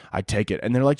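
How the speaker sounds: ordinary talk, nothing marked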